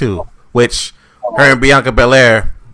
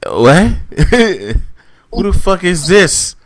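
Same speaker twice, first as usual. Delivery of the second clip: higher-pitched retold "what the hell" reaction; baffled disbelief; 9 semitones higher, slower than usual. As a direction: question it in mock bewilderment. Retold baffled reaction to Vince's pick.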